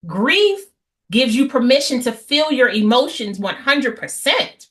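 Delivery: usual speaking voice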